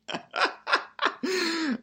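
high-pitched burst of laughter; long, high, tickled laugh. Real, not acted. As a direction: laugh high at your own joke. Laughs at the tourist bit.

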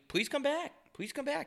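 high, soft begging plea; pleading hotel voice. Retold or imitated. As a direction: beg them to come back. Hotel begging guest to return.

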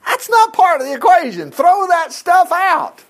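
high, loud, slowing to a drawn-out end; high emphatic rejection. Real, not acted. Preacher tells the congregation to drop doubt, high and loud. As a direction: throw the idea out with scorn.

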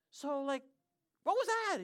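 high-pitched, loud bewilderment; baffled question. Retold or imitated. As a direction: imitate yourself asking in utter bafflement. Retelling his baffled question to pastor.

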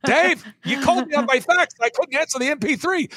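bumble like a confused grandpa mixing up gadgets; senile old man. Retold or imitated. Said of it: "talk like this".

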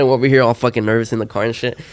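usual speaking voice

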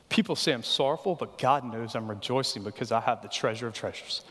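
usual speaking voice

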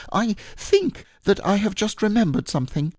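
high, hurried little voice; making an excuse to slip away; nervous Piglet. A narrator's reading. Piglet making excuses to Pooh, high and nervous. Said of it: "said Piglet".

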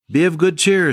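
preacher's joyful call to cheer; exultant call. Acted. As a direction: ring it out with joy. Jesus voiced, lifted high.

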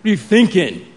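loud, incredulous parent voice; half-laughing stern parent; dad scolding his toddler, mock stern; grown-up voice. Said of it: "that's my grown-up voice".

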